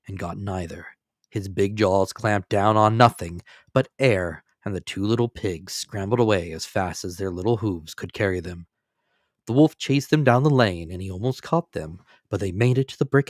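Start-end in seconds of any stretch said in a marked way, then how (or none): none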